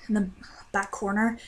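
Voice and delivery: usual speaking voice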